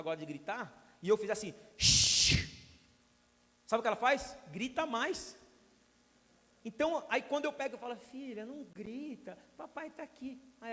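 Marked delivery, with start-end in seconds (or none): 1.8-2.5 a sharp, loud shushing sound; hard hiss of a shush; loud hush. Retold or imitated. Hushing the screaming daughter. 8.0-10.4 slow, soft, higher comforting daddy voice; hushed loving reassurance; tender soothing. Retold or imitated. Father soothing crying daughter.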